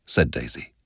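ordinary delivery, nothing marked

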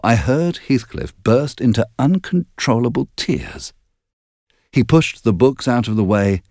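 ordinary delivery

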